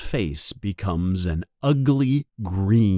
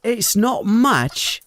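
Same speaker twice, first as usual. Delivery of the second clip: Ron voice; 11 semitones higher, slower than usual. A narrator's reading.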